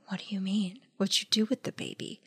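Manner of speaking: very soft, near-whispered acted question; hushed, stunned questioning. Exorcist asking the girl about the baby, hushed imitation. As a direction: drop to an appalled hush.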